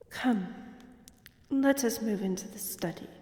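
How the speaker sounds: ordinary talk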